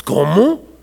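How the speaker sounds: ordinary talk, nothing marked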